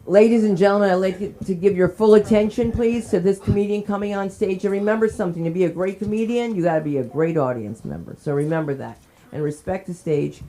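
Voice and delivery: host presenting the next comedian to the audience; emcee announcement. Acted.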